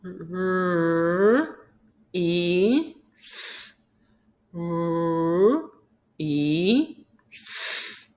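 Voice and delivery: REEF as separate sounds, long r, ee, f; sounded out. Shown as a demonstration. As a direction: hold each sound in R, E, F, pausing between. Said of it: "to say each sound".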